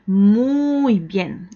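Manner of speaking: long stretched first word; drawn-out praise. Real, not acted. Elogio alargado al alumno. (elogio con la primera palabra alargada)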